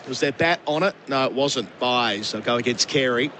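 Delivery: lifted commentator voice, easing as play settles; live play-by-play. Real, not acted. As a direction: call the runs as they happen.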